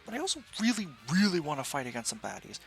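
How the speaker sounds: eager outburst. Acted. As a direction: burst out with eager longing. Eager hero wish.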